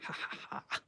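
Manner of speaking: soft unvoiced chuckle to herself; a quiet, breathy little laugh. Real, not acted. Laughs at her own work.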